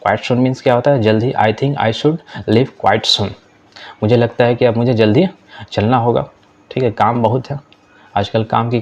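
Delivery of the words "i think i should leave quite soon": slow, careful classroom English; deliberate teacher read-aloud; slow model reading. Shown as a demonstration. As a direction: spell each word out slowly for learners. Teacher reads model sentence.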